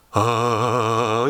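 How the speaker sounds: low long 아 groan; imitated faint moan; collapsed moan. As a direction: moan like someone fallen backwards. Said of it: "이러면서 뒤에 쓰러져가지고".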